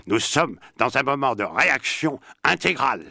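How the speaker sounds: loud, high-pitched declaiming impersonation; Sartre. Acted. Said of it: "Je vais le dire avec la voix de Sartre".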